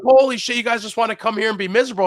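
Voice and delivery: loud, high, fast disbelief; incredulous. Retold or imitated. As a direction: throw up your hands.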